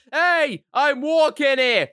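high, drawn-out pedestrian yell; hammed-up street shout; mock indignant shout. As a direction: holler it at a driver in mock outrage. Acted yell at a stopped car.

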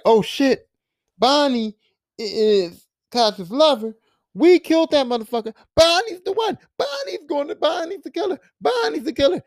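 rising from high to very high, building alarm; the penny drops and panic climbs; a mock panicked epiphany, climbing from high to very high; shocked realization. [name] realizes Bonnie is killer.

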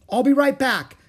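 high, loud call. A narrator's reading. Jack to Annie as he turns back, calling out. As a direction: call back over your shoulder. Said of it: "Jack called".

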